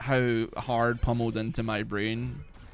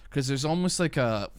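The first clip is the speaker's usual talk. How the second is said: usual speaking voice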